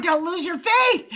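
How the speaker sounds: hammed-up preachy panic about losing faith; mock church warning.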